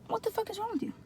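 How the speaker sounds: high, soft squeaky disbelief; retold indignation. Groggy patient being rushed out, high hushed indignation. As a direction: squeak it under the breath.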